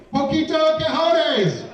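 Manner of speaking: high, drawn-out holler; mock yelling. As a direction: yell it like a whole resort greeting him. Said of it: "everybody's yelling at me".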